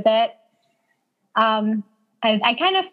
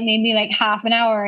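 ordinary talk, nothing marked

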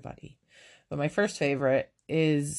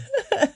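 real laugh jumping high; high burst of laughter; 4 dB louder than usual. Real, not acted. Podcaster cracking up at her cohost, high laugh.

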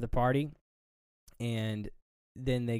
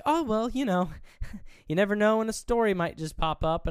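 high, sing-song mock reporter voice, much higher; imitating reporter; 10 semitones higher, 5 dB louder than usual. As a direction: put on her coy high voice, laughing. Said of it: "She's like".

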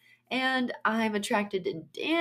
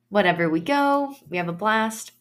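usual speaking voice

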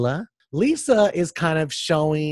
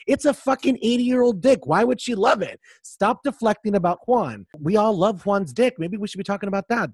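higher, louder exasperated rant; heated rant; 8 semitones higher, 3 dB louder than usual. Real, not acted. Host ranting at Robin over the Juan gossip, fired up. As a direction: tear into her, fired up.

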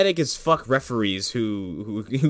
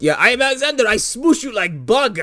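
thick mock Russian villain; Russian accent; 10 semitones higher, 4 dB louder than usual. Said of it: "doing a Russian accent".

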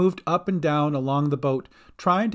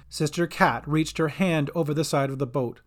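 usual speaking voice

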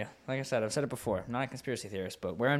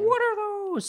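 squeaky outburst; 7 dB louder, slower than usual. Acted. Mock squeaky exclaim.

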